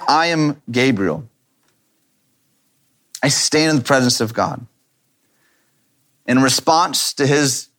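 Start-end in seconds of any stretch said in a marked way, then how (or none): 0.1-4.6 authoritative Gabriel. Retold or imitated. Preacher imagining Gabriel's tone to doubting Zechariah, mild authority. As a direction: lean into Gabriel's claim of standing, unhurried. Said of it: "can't you hear Gabriel saying".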